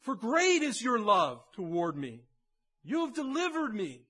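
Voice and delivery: loud, fervent proclamation, rising high; passionate. Shown as a demonstration. Pastor showing David's real prayer, loud and passionate. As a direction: cry it out with your whole heart.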